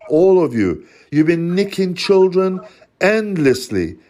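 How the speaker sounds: usual speaking voice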